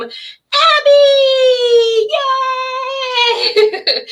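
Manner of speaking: put-on high happy baby-talk cheer; excited motherese. Shown as a demonstration. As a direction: squeal it up high to delight a baby. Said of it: "my voice goes higher with babies, and that's called motherese".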